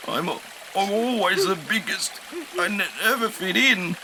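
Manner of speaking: high broken tearful voice; sobbing. A narrator's reading. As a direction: choke on the words. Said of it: "Dippy sobs".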